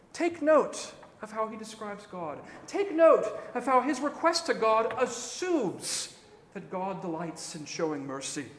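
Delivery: usual speaking voice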